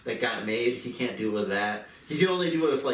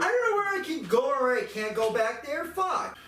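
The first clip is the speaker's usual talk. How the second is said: exasperated rant. Real, not acted. Complaining about the open back area, indignant rant. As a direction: vent your outrage at the store. Ranting at the store manager.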